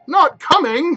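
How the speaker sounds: high, loud dismayed cry. Acted. Bob cries out, dismayed his daughter isn't coming. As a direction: let his spirits drop in shock. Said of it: "with a sudden declension in his high spirits".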